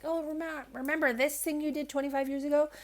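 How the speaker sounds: high, softened voice of a negative thought; nagging head voice. Acted. Head voice dredging up an old mistake to cause worry, nagging. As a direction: needle in a high, light inner-critic voice. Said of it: "negative thoughts come from that voice".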